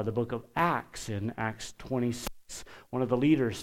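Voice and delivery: ordinary delivery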